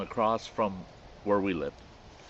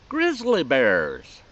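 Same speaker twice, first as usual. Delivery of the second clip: drawn-out, loud holler, pitched up; old mountain man; 4 semitones higher, 7 dB louder, much slower than usual. Retold or imitated. Old mountain man answering Jeremiah's question, loud drawn-out holler. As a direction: stretch it out loud like an old backwoods hunter. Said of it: "just the way that old boy said that".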